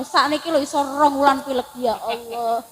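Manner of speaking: normal talking voice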